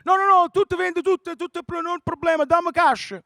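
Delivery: put-on high, loud, pushy old caller; high pushy radio caller; insistent Italian caller. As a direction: brush off the host, loud and high.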